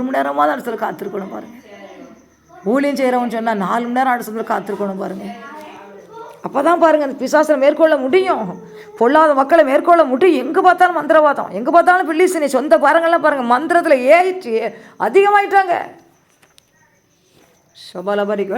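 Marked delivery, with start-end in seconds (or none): 6.5-15.8 loud preacher's cry, rising to a peak; fiery preaching. Acted. Preacher warning believers about witchcraft, loud and rising. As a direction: thunder it at the crowd.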